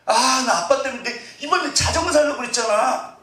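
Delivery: put-on high-pitched childish complaint; whining child. Child blaming dad over a promised bicycle, whining. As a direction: whine at dad in a mock kid voice.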